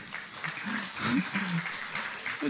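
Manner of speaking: a real laugh of joyful disbelief. Real, not acted. Testifier laughing at the unexpected money, joyful laugh. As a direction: laugh with relief and wonder.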